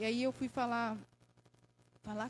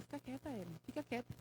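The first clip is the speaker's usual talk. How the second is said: retold hushing; 12 dB quieter than usual. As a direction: quietly hush your client, as the lawyer did. Lawyer hushing her during the hearing.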